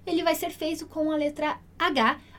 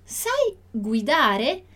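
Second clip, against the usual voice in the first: slow model reading; 5 dB louder, much slower than usual. Shown as a demonstration. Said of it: "dillo chiaro".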